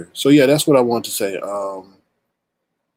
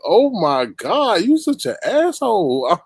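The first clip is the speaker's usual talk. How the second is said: high, drawn-out mock dismay; slow, exaggerated scandalized reaction; retold disbelief; 9 semitones higher, slower than usual. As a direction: stretch it out high, appalled in the retelling. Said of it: "I was like".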